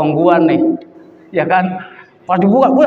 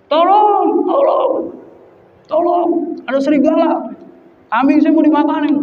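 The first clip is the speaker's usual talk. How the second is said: mock cry for help; 9 semitones higher than usual. Young herder pranks villagers about a wolf, mock cry for help. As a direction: act out a villager's yell for help.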